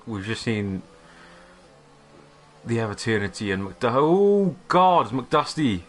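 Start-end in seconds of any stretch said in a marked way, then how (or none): 3.9-5.8 high, loud and drawn out in disbelief; a slow, stunned oh God; shocked exclamation. Real, not acted. Sees a car stay on track.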